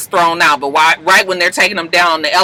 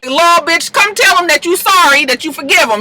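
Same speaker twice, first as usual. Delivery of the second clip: high-pitched, insistent urging; urgent demand; 11 semitones higher than usual. Retold or imitated. Friends pushing Laura to calm Connor down, urgent demand. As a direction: order her over, voice jumping up.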